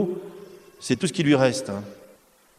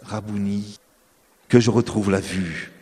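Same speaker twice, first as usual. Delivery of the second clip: a hint of a pleading blind man, lower; lightly voiced beggar asking Jesus; 4 semitones lower than usual.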